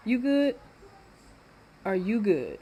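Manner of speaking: sassy are-you-okay to someone nearby; mock concern. Talking to something off mic.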